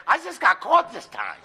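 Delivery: high-pitched wife voice talking back; hammed-up defiant wife. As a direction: argue back high and unbothered. Acted wife answering back in joke.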